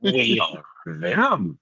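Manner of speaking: slow, drawn-out monster voice; Venom voice. Pretend. Repeating the voice on request, slow Venom voice. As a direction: stretch each word like a symbiote. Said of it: "Say that voice one more time".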